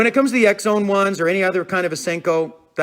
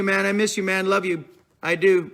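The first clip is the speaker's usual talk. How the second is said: ordinary talk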